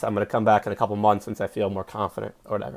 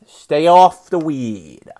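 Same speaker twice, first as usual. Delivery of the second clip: loud, slow, drawn out; Stephen A Smith; 4 semitones higher, 7 dB louder, much slower than usual. Retold or imitated. Comic repeating the pundit line, slow and loud. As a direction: stretch every word like a pundit. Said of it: "Stephen A Smith".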